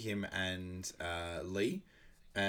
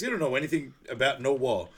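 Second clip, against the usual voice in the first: higher, louder retold scoff; mock challenge; 5 semitones higher, 6 dB louder than usual. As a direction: imitate dismissing a partner's singing. Acted film banter about singing.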